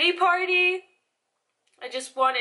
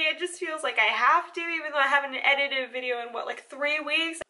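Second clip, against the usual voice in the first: ordinary talk, nothing marked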